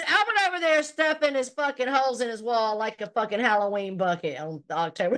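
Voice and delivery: usual speaking voice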